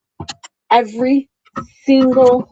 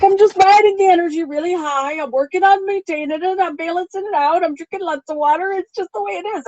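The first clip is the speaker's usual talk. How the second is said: excited bright retelling; 8 semitones higher than usual. Retold or imitated. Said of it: "I'm like".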